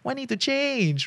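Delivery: lazy Singlish buddy voice, high and slow. Retold or imitated. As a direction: play the chill friend in Singlish. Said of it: "ask you to chill".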